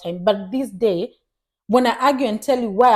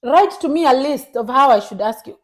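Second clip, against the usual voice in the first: raised pitch, acted exasperation; exasperated comeback; 5 semitones higher, 6 dB louder than usual. She answers partner who disliked her question, exasperated. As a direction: throw the demand back, fed up.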